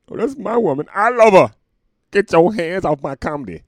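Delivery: a hammed-up jealous boyfriend guarding his woman; jealous lover. Comic playing a jealous lover over his comedy, loud mock yell.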